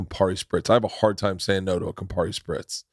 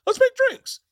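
eager cheer. Retold or imitated. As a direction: sell the party idea with glee. Host eagerly agrees to drinks.

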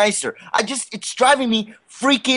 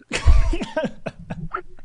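burst of laughter. Real, not acted. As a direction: break into laughter. Laughs after prank call ends.

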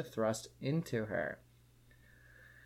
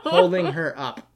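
laughing; 3 semitones higher, 9 dB louder than usual. Real, not acted. Laughing through smutty reading.

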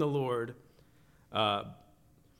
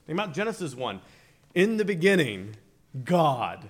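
raised, forceful sermon delivery; emphatic preaching; 7 semitones higher, 10 dB louder than usual. Real, not acted. Preacher teaching new creation in John's Gospel, emphatic preaching. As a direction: preach it out, punching the key words.

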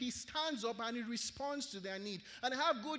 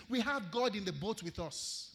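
ordinary delivery

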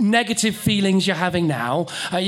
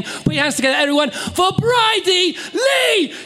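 announcer shout; 4 dB louder, slower than usual. Real, not acted. Host introduces next act.

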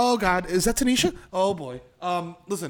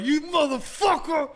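high, drawn-out curse at a machine; mock rage; 10 semitones higher, slower than usual. Punching a broken touchscreen in hell, mock rage. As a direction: draw the curse out in hammed-up fury. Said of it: "You punching the touchscreen like".

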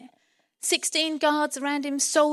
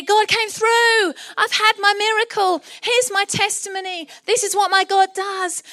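loud, high triumphant proclamation; exultant testimony; 7 semitones higher, 6 dB louder than usual. Acted. Peter arriving at church to testify, exultant and loud.

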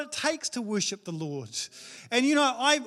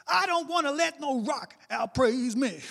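impression of a worship leader; 6 semitones higher than usual. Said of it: "He's like".

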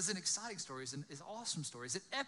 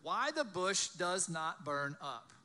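loud, higher-pitched storytelling voice; dramatic scripture reading; 4 semitones higher, 6 dB louder than usual. A narrator's reading. Preacher reading the burning bush story aloud, loud and dramatic. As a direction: read the Bible story out to a crowd.

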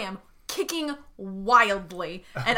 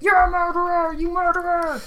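screaming; 7 semitones higher than usual. Pretend. Captive screaming while dragged out, high wail. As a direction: keep wailing the accusation in a raised, stretched voice. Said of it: "screaming this entire time".

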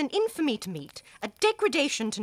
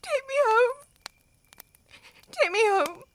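higher, crying plea; tearful plea; 12 semitones higher, 3 dB louder than usual. Acted. Young wife begging to be taken home, crying. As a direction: break down and beg through tears.

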